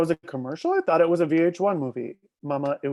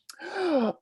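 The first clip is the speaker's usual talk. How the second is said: high startled gasp; sharp gasp; 14 semitones higher than usual. Retold or imitated. Spotting a familiar face, startled gasp. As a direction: catch your breath in surprise. Reacts to seeing someone.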